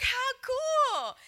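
very high, loud drawn-out squeal; thrilled. Retold or imitated. Reacting to the coworker's matching news, thrilled. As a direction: squeal with delight at the coincidence.

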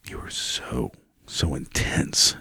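slow breathless whisper; mock whisper. Real, not acted. Vlogger mocking an actor's intensity, whispered. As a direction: hush it like a mock-awed fan.

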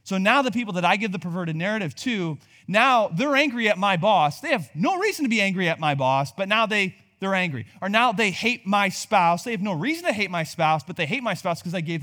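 loud, high emphatic preaching; preaching at full force. Acted. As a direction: hammer it home to the congregation.

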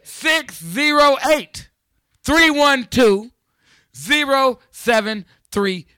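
high, loud and slow, each digit stretched; loud slow announcement. Acted. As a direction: spell out the number big and slow. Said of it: "Let me rewind that".

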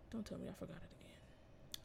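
whispered to herself, no voice at all; a breathy self-scolding whisper. Real, not acted. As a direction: hush it like an aside to yourself. Muttering to herself about forgetting.

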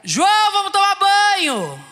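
put-on high, loud, slow shout at a child; an exasperated mother shouting for her son to bathe; mother's yell. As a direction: yell it out, patience gone.

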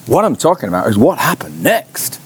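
ordinary delivery

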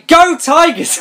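high, drawn-out pep-rally yell; mock school cheer. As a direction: cheer it like an over-keen student.

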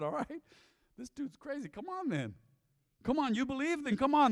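high, amused, beckoning Jesus voice; playful Jesus. Acted. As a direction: call him over like an amused friend. Preacher voices Jesus inviting Peter.

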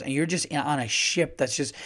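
ordinary delivery

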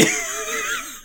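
very high-pitched laugh; real laugh. Real, not acted. Cracking up after imitating wrestling fans, high real laugh. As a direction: burst out laughing at your own impression. Laughs at own fan impression.

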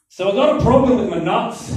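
put-on loud, high-pitched comic Scottish accent; thick Scottish accent. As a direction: imitate a Scottish mate blurting it out. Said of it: "thick Scottish accent".

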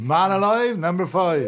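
cheery rhyming bingo call; bingo caller. Acted. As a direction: sing out the bingo rhyme. Bingo rhyme with number.

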